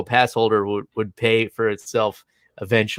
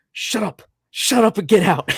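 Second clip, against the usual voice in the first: higher-pitched, emphatic dismissal; retold exasperated command; mock angry order; 7 semitones higher than usual. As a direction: imitate an irate man refusing to listen. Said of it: "just lost his shit".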